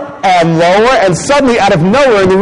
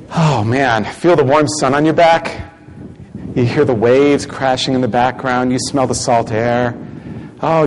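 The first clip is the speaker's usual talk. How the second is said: guided visualisation; 7 semitones lower, 4 dB quieter than usual. Acted. Leads a beach visualisation.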